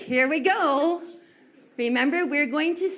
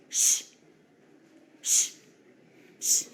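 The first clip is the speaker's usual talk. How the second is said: long voiceless shh, three times; shushing. Shown as a demonstration. Teacher showing children the shh sound to replace a number. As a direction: hush it out like quieting a room. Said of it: "So we're going to say".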